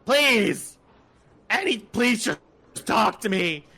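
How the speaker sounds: high, loud pleading hawker's cry; frantic high-pitched street seller; desperate vendor shouting. Acted. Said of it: "shouting at you".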